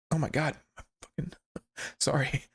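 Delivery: talking through hiccups; hiccuping. Real, not acted. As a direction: hiccup mid-sentence. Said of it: "I keep, like, hiccuping".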